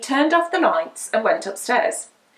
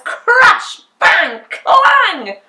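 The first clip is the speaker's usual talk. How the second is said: crashing noises; 10 semitones higher, 8 dB louder, much slower than usual. A narrator's reading. Armour rolls down stairs.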